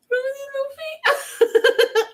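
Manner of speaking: very high, slow squeal breaking into laughter; overwhelmed by how cute it is; squealing at cuteness. Real, not acted. Crafter gushing over the chicks' tiny feet, laughing squeal.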